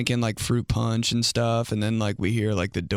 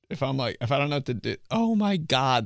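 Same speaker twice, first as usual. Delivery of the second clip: ordinary delivery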